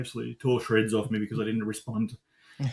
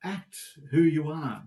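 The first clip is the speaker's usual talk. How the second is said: usual speaking voice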